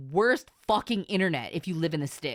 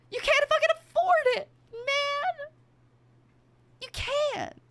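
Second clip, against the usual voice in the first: high, wailing mock-cry; exaggerated whiny cry about money; whiny despair. Real, not acted. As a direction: wail it like a hopeless complaint. Ranting about unaffordable housing.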